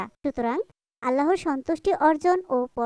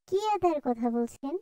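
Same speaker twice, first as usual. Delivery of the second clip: ordinary talk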